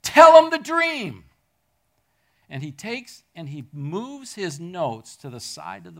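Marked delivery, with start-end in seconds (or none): 0.0-1.2 high, loud, slow shout from behind; screamed call. Retold or imitated. Gospel singer shouting to Martin at the podium, high and loud. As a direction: yell it across the stage to stir him. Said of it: "she screams".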